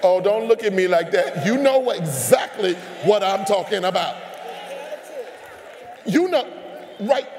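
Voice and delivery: loud, teasing call-out to the crowd. Real, not acted. Preacher teasing the congregation at full volume. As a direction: raise it and call them on it.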